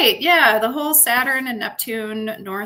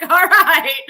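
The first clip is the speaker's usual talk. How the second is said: laughing through the words; 7 semitones higher, 8 dB louder than usual. Real, not acted. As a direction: laugh it off, mocking him. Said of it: "I'm laughing at him".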